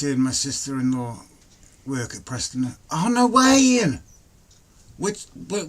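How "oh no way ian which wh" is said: much higher and louder, delighted disbelief; surprised. Real, not acted. To a viewer whose sister-in-law works at Preston, real surprise. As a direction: gasp out real amazement at the news.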